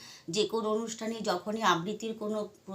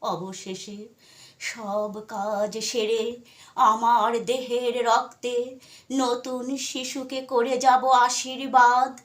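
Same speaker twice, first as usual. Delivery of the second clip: measured, declamatory Bengali recitation, much louder and higher than chat; poetry recitation; 5 semitones higher, 9 dB louder, slower than usual. Acted. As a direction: recite with drawn-out words and pauses, swelling on the resolute lines. Recites a Bengali poem by Sukanta. (আবেগ দিয়ে কবিতা আবৃত্তি)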